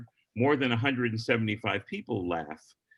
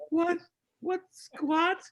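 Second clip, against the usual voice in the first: high, squeaky shocked what; a panicked double take, pitched way up; stunned disbelief; much slower than usual. Retold or imitated. Comedian sees the lineup and freaks out: high stunned what.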